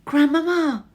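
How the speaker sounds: high, loud call through the door; boy shouting as loud as he can. Acted. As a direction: shout for grandmama. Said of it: "I shouted as loudly as I possibly could".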